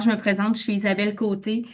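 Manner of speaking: ordinary talk, nothing marked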